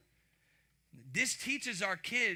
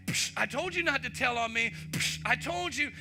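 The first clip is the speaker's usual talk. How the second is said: higher, louder sibling gloat; taunting sibling; 5 semitones higher, 5 dB louder than usual. Retold or imitated. Kid to his brother once the parent leaves, a mock gloat.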